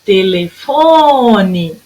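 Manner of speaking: "Telefone" slowly, with FO held very long; calling the word. Shown as a demonstration. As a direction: draw out the stressed FO in "Telefone". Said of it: "a hora que eu chamar".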